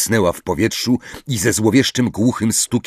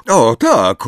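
louder, higher, firm answer; hard as steel; 4 semitones higher, 6 dB louder than usual. A narrator's reading. Hermione answers Harry, voice hard as steel. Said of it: "głosem twardym jak stal".

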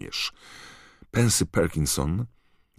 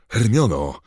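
loud, raised call of her name; alarmed call; 7 semitones higher, 8 dB louder than usual. A narrator's reading. Ron rushes to injured Hermione, alarmed call. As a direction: cry out to a hurt friend.

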